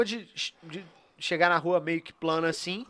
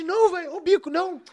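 mock pleading voice; 8 semitones higher, 9 dB louder than usual. Imitating pleading friend.

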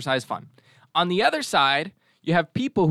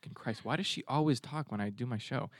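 grumbling under the breath; annoyed muttering; 2 semitones lower, 14 dB quieter than usual. Real, not acted.

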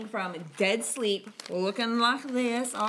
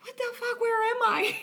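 high-pitched mock confusion; bewildered; 14 semitones higher than usual. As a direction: act lost and just woken, voice pitched way up. Acting out bewildered, half-asleep captain.

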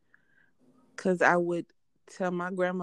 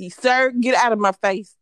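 loud, higher-pitched acted warning; mock telling-off; 4 semitones higher, 10 dB louder than usual. Acting out warning someone before a slap, loud mock telling-off. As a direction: order them off with loud mock formality.

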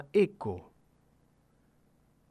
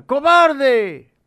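much higher and louder furious yell; angry shout; 12 semitones higher, 12 dB louder than usual. A narrator's reading. Angry boy yells at the mountain, furious shout. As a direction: hurl the insult across the valley. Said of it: "Enojado, el niño grita".

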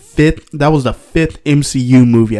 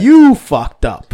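higher, louder, each word drawn out; slow loud emphasis; 7 semitones higher, 6 dB louder, much slower than usual. Real, not acted. Teasing co-host, repeated for emphasis.

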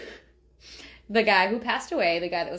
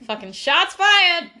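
much higher, louder slow burst; excited exclamation; 9 semitones higher, 8 dB louder, slower than usual. Real, not acted. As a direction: announce it like breaking news, big. Dramatic exclamation.

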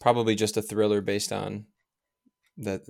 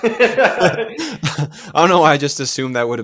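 loud, high, laughing through the words; real laughter bubbling through speech; laughing admission; 5 semitones higher, 8 dB louder than usual. Real, not acted. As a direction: own up while cracking up. Laughing at own wrong assumption.

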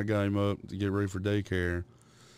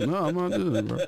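mock refusal; 8 semitones higher, faster than usual. Arguing with toddler son.

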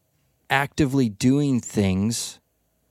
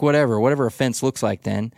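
ordinary talk, nothing marked

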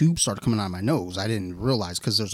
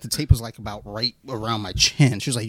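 ordinary delivery

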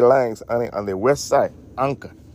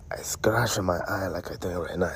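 hushed whisper; whispered confession; 5 semitones lower, 10 dB quieter than usual. Acted. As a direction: whisper it close. Whispered aside.

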